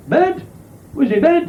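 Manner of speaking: high, baffled woman's voice; a naive woman asking about the stereo; puzzled woman. Acted. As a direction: squeak the question in disbelief.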